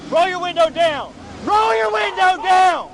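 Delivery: loud, high shouted command; shouted surrender demands. Real, not acted. Officer ordering driver at gunpoint.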